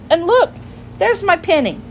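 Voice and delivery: high, excited discovery; acted childlike delight; delighted little girl. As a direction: exclaim high as you spot it. Lucy finds her pinny, delighted.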